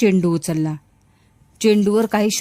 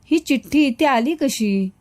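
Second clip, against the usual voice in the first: mildly fearful, higher-pitched question; trembling question; 5 semitones higher than usual. A narrator's reading. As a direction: ask with a touch of nervous trembling, pitched up. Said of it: "थरथरत प्रश्न विचारला, हळूच". (किंचित थरथरत, उंच स्वरात प्रश्न)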